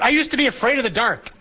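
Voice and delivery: put-on high, childlike blurt from a small boy; little boy blurting out. A boy blurts his secret to the priest at dessert, high and childlike. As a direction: pitch it up like a five-year-old confessing.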